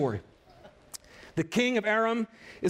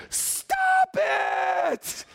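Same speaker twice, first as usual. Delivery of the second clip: mock scream; 11 semitones higher than usual. Volunteer on the dock yelling at swimmers, high mock shriek. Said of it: "she was just screaming".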